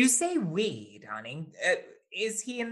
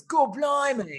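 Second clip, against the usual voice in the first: higher, broad Cockney exclamation; Cockney accent; 7 semitones higher, 4 dB louder, slower than usual. Shown as a demonstration. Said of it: "as it got more and more Cockney".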